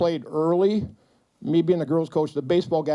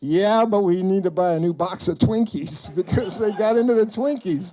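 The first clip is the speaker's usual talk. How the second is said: laughing through the words. Real, not acted. Retelling coach's answer.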